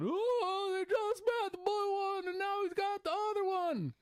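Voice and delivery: very high, whiny mock complaint; mock whining. Mocking fans moaning about the new championship, high whine. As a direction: moan it in a squeaky crybaby voice. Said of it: "people were bitching and moaning about".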